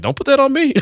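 a hint of an indignant mother; imitating mom. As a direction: toss it back like a mother refusing blame. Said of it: "My mom's like".

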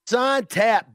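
loud, high show title call; shouted title. Acted. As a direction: punch out the title big.

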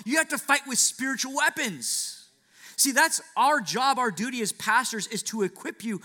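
loud, high, rising preacher's call; preaching at full force. Acted. Pastor exhorting the congregation, loud and high. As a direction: drive the charge home to the church.

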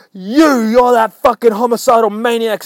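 high, loud mock outrage; accusing shout. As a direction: yell the accusation in comic horror. JJJ accuses the Punisher, acted.

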